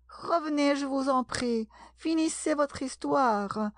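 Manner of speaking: high, pleading call. A narrator's reading. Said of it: "lui cria Alice". (appel suppliant, d'une voix aiguë)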